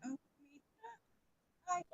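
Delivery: normal talking voice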